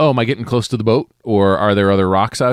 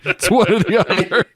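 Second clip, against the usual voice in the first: slow, laughing reply; 11 semitones higher, slower than usual. Real, not acted. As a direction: speak through laughter. Laughing at the joke.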